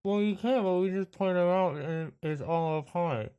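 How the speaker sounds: a hint of a weary phone agent; travel agent voice. GM plays travel agency worker.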